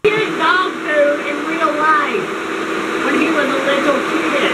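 slow, loud announcement; calling over noise. Real, not acted. Teasing friend over the running blender, calling out.

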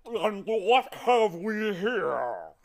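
booming, drawn-out giant voice; giant's roar. A narrator's reading. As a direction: roar it slowly like a huge giant. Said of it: "he roared".

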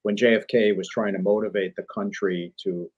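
ordinary talk

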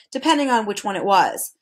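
ordinary delivery, nothing marked

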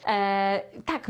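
ordinary delivery

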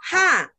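HA high, dropping sharply; short, forceful falling "Ha"; fourth tone. Shown as a demonstration. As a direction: drop "Ha" sharply from a high start. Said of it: "Nada empat seperti ini".

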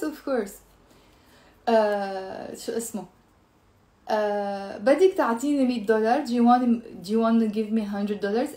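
usual speaking voice

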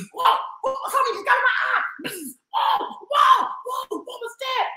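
hammed-up startled yelps; mock surprise. Storyteller pretends something got in her eye, high mock alarm.

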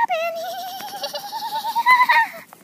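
very high, drawn-out giggle breaking into a cackle; shrill-pitched silly laughing fit; high cackling laugh. Pretend. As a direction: let out a long, squealing mock cackle. Pretend creature attack, long high cackle.

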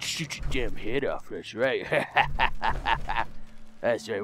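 very low and soft, with a chuckle; muttered mock threat. Player threatening under their breath, muttered mock threat.

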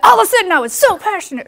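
sudden passion. Shown as a demonstration. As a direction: switch mid-sentence into a fast, loud, fiery burst. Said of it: "I went from just talking like this".

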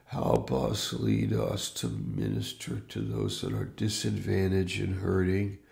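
ordinary delivery

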